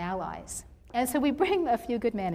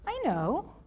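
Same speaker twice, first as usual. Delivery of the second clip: higher, hesitant retold reply; imitated uneasy answer; 7 semitones higher than usual. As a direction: act out an uncomfortable man admitting it.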